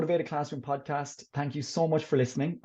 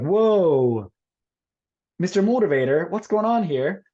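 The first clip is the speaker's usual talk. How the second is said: loud, higher, hammed-up exclamation; mock alarm; 4 semitones higher, 7 dB louder, slower than usual. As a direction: sound the alarm like a game-show host. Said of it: "then I'll be like".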